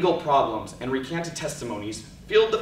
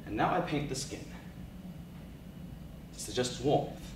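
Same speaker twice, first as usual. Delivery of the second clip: spoken word poetry; 7 dB quieter than usual. Acted. Poet telling the audience about the morgue, hushed spoken word. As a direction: let the images land in hushed pauses.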